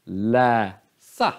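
Läsa split in two, second syllable lifted; grave accent. Shown as a demonstration. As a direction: hold Lä low, then jump up on sa. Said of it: "det är grav accent".

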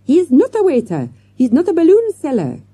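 high, loud, agitated protest; crying out. A narrator's reading. French manager correcting the children, crying out. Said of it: "cried the manager".

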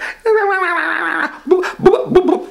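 high squealing laugh of joy; happy look laugh. Acted. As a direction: squeal like a delighted kid. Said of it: "Here's my happy look".

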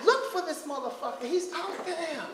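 ordinary talk, nothing marked

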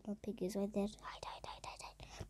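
faint, scared hushed whisper; frightened whisper. Real, not acted. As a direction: whisper so nobody hears. Hiding from piggy.

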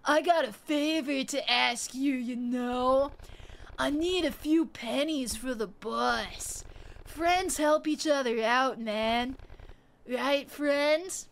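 slow, spaced-out street guy; stoned alley stranger asking for bus money. Acted. Voices the high alley stranger.

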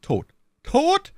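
high, loud cry of disbelief; disbelieving cry. A narrator's reading. Cardinal learning that My Lady is dead, disbelieving. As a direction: exclaim it as a stunned question. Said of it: "rief der Kardinal, der an das".

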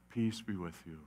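calm frightened friends softly; gentle Jesus greeting. Acted. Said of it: "Jesus says".

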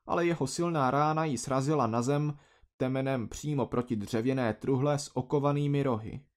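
plain reading voice

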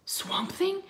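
put-on high, worried little boy; timid question. A narrator's reading. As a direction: squeak it from a hiding place. Hidden twin alarmed.

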